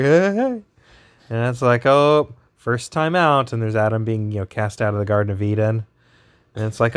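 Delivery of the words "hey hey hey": high, loud cheesy cheer; cheesy hey hey hey. Acted. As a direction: call it out like a proud goofball. Adam cheering in baby book.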